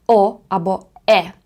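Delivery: normal talking voice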